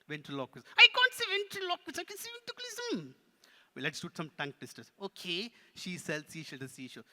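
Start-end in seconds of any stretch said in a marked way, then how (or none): 0.8-3.1 high, loud puppet wail; protesting puppet. Pretend. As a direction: protest loudly as a frustrated puppet. Puppet protests once more.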